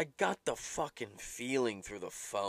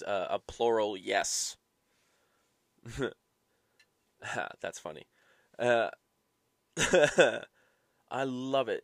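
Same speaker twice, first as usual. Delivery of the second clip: ordinary talk, nothing marked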